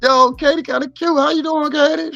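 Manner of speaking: high, sing-song flirty call to Katie; playful flirt. Acted. Streamer flirting with Katie from the chat, high sing-song voice. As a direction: croon her name up high like a smooth talker.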